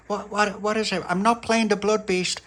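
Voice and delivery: put-on a kid refusing a game; wary girl. Pauline questioning little pappy, mildly wary. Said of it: "she went".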